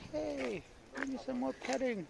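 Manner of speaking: high, soft pleading dog voice; voicing the dog.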